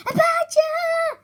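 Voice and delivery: frightened squeak. Acted. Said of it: "squeaked in fright".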